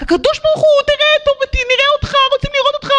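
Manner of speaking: very high pleading cry; Elijah crying out. Retold or imitated. Elijah on Mount Carmel crying out to God. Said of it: "וצעק".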